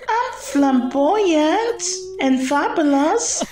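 a downcast flamingo complaining; sad flamingo Floss. Acted. Floss sad about weak legs.

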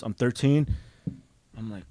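ordinary talk

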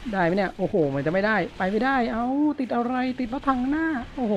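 high, exasperated yelps at being stuck; frustrated pitch rise. Real, not acted. Gamer stuck on a tank, high exasperated cries. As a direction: squeak with disbelief as the tank jams.

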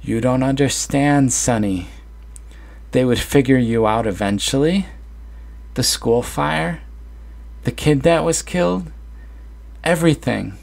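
mother urgently telling her son the truth. Acted.

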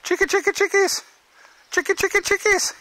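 high, loud sing-song call to the hens; chicken call. Real, not acted. Calling his chickens over to the camera, high sing-song call. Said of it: "let's see if I can".

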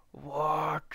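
high, loud disbelieving what; shocked yell. Real, not acted. Dropped items, disbelief.

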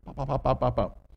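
rapid-fire little pops; popping popcorn. Shown as a demonstration. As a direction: imitate kernels popping on a grill. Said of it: "like popcorn on a hot grill".

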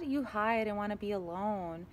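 ordinary talk

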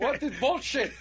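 high, squeaky bad Russian accent; mock Russian accent. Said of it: "my accent's not great".

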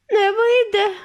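high, loud cry; desperate shout. A narrator's reading. Mrs TV shouting back at Wonka, high and loud. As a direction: shout it in despair. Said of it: "крикнула пані Тіві".

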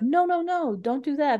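high, urgent imitation of a warning; head someone off, voice jumping up high; mock alarm. Operators stopping the driver pulling alongside, mock alarm.

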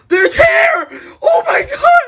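very high, tearful shout; horrified shriek. Acted. Finding hair after the dye, horrified shriek. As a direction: shriek it in panic.

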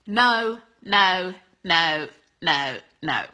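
emphatic repeated no, loud and dropping; firm no. Real, not acted. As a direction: shut it down with a firm no. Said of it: "rejecting the bad apology".

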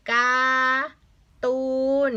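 กา, pause, ตูน, both drawn out; slow split model of การ์ตูน; syllable-by-syllable reading. Shown as a demonstration. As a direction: sound out การ์ตูน as two long beats. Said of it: "อันนี้อ่านว่า".

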